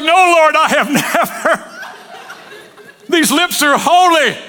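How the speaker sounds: hammed-up holy protest, slow at the end; mock pious refusal. Baptist refusing God's craft beer, mock pious horror. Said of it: "You're like".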